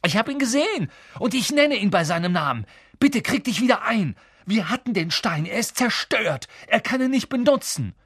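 high, insistent pleading; heated, high-pitched protest; urgent. A narrator's reading. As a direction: talk sense into someone upset, emphatically. Harry urgently arguing with weeping Hagrid.

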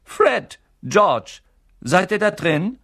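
high, loud call from outside; calling mother. A narrator's reading. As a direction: call out for the boys. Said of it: "eine Stimme ... hereingeschwebt".

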